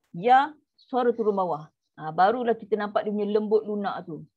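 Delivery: ordinary delivery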